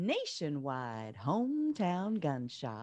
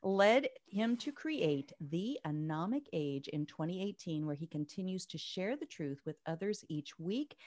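ordinary talk, nothing marked

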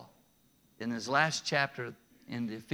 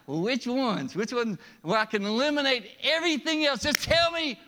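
high, insistent haggling; pushy bargaining; 7 semitones higher, 4 dB louder than usual. Retold or imitated. Preacher mocking the rich man haggling over commandments. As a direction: demand the loophole, pressing higher. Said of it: "No, he's like".

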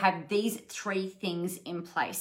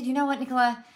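put-on English accent; 4 semitones higher, 5 dB louder, faster than usual. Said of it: "In her very English accent".

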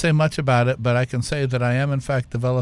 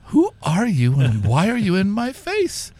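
higher, slow, incredulous questioning; bewildered demand; 7 semitones higher, 3 dB louder, slower than usual. Retold or imitated. Co-founder confronting the strange biker, mock bewildered demand. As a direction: imitate someone baffled by a stranger.

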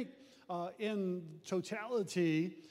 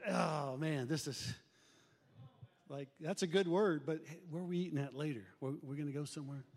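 low, softening distracted aside; mock disengaged; 6 semitones lower, faster than usual. Church member losing interest during the sermon, half-hearted and distracted. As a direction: drift into lunch plans, voice dropped. Checked-out back-row member murmuring.

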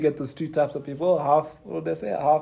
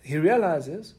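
ordinary delivery

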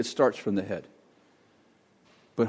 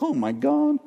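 awestruck; slower than usual. Retold or imitated. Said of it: "you wake up one day and you're like".

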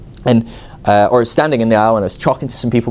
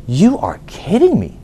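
slow, high, disbelieving indignation; indignant inner voice; 8 semitones higher, slower than usual. Retold or imitated. Brushed off by the pastor, slow indignant disbelief. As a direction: let the disbelief drag out slowly.

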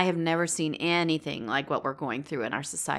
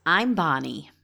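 sophisticated voice. Pretend. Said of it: "I thought I would try to sound sophisticated".

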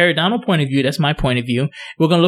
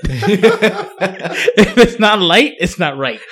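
laughing; 2 semitones higher, much slower than usual. Real, not acted. Dentist repeats the much-teased line, laughing. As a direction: draw it out through laughter.

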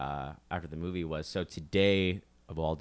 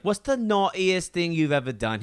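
put-on high, loud British child's question; British kid; 10 semitones higher, 7 dB louder than usual. Kid calling a British radio show to question the actor, high British child voice. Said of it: "one kid was like".

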